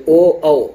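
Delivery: او او with an open "o", not a pure oo; wrong "ao" sound. Shown as a demonstration. Teacher picks out the faulty vowel, او as broad ao. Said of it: "غلط کیا ہے؟".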